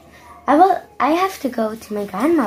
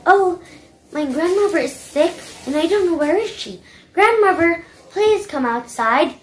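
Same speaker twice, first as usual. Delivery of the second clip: excited pleading; 7 semitones higher than usual. Acted. Girl calling for grandmother.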